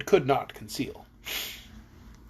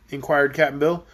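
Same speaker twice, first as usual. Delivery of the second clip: plain reading voice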